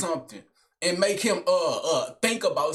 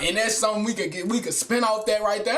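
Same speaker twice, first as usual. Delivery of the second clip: ordinary talk, nothing marked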